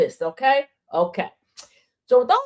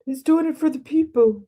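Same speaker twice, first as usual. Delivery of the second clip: high mock protest on someone's behalf; imitated insistent apologist, raised pitch; mimicked defenders; 4 semitones higher than usual. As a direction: pitch it up, mocking their excuse. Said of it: "they're quick to go".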